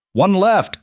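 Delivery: loud, high shout; shouting over gunfire. A narrator's reading. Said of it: "Caleb shouted over the gunfire".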